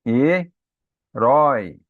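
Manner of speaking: Herói stretched slowly and loudly, with an open ó; open diphthong drawn out. Shown as a demonstration. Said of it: "Por que que ele é aberto?".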